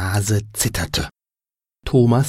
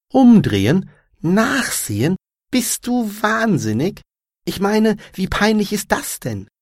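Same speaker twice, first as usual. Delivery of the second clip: appalled disbelief; 10 semitones higher than usual. A narrator's reading. Anja rejects Thomas's advice to turn and look, incredulous. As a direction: throw the suggestion back, aghast.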